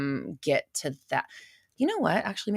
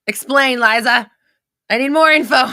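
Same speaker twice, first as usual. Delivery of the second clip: higher, much louder calls for more; excited demand; 8 semitones higher, 12 dB louder than usual. Real, not acted. Host calling out to a viewer for more info, loud and eager. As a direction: call out eagerly for the full story.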